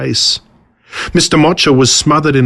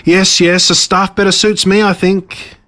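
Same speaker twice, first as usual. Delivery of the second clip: higher, self-pitying boast; gloating villain; 7 semitones higher than usual. Acted. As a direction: insist on his friends with wounded pride. Villain picks a staff.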